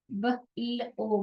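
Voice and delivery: each letter ब ल ओ said slowly and separately; sounding out letters. Shown as a demonstration. Teacher sounding out letters for the class. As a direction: spell out ब ल ओ sound by sound. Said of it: "ब्लो".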